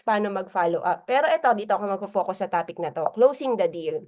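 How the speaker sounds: ordinary delivery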